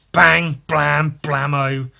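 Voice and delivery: loud, punchy comic-book bangs; imitated gunshots. Gun Viking shooting attackers, loud spoken bangs. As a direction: punch each word as a separate shot.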